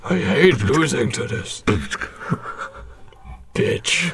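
slow, sulky muttering; sulky Hayden impression. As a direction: sulk like a sore loser. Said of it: "That's how he speaks".

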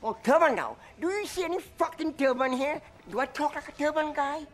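high-pitched indignant outrage; offended, climbing indignation; outraged. Acted. Outraged reply to turban insult.

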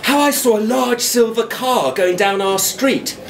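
plain reading voice

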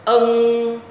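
"Ân" humming through the nose, loud, high and long; nasal throat sound. Shown as a demonstration. Teacher models the nose sound, long nasal "Ân". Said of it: "Âm mũi họng".

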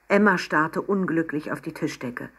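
ordinary delivery

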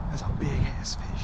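hushed whisper. Real, not acted. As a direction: whisper it under your breath. Whispering while landing the fish.

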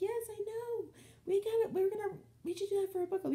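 high, thrilled gush; high-pitched giddy book-club plan; excited squeal. Retold or imitated. Excited reply to Michelle.

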